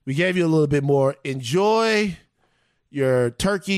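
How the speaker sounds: ordinary delivery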